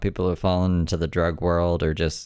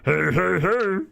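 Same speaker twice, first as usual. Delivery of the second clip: much higher, a loose cartoon call; a light imitation of a cartoon greeting; mock Fat Albert hey; Fat Albert catchphrase; 12 semitones higher, slower than usual. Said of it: "sounds like Fat Albert".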